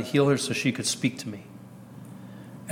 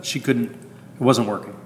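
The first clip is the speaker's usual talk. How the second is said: ordinary delivery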